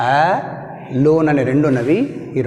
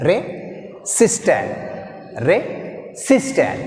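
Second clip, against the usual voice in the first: "Resistant" very slowly, pause after Re; Re, a gap, then stressed SIS-tant in "Resistant"; split, stressed second syllable; 2 semitones higher, 4 dB quieter, much slower than usual. Shown as a demonstration. Teacher breaking the word up for learners, "Resistant" split with SIS stressed. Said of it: "second syllable take".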